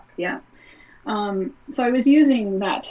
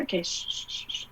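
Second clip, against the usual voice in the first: hushing whisper; 2 semitones lower, 9 dB quieter, faster than usual. Retold or imitated. Hushing her own abuser.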